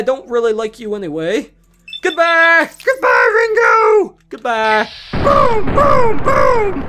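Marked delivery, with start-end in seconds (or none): 2.0-4.9 high, loud gleeful shout; gleeful farewell shout; shouted goodbye. Real, not acted. Shooting Ringo in game. 5.1-6.8 high loud mock explosions; gleeful blast noises; boom sounds. Real, not acted.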